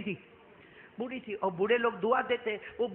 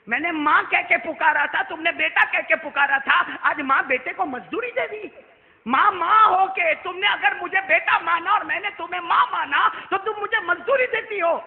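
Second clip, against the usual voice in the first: high, loud preaching that climbs to a shout; impassioned sermon delivery; full-force preaching; 9 semitones higher, 12 dB louder than usual. Acted. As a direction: preach it out to the crowd, rising to a cry. Prophet weeping, preached loud.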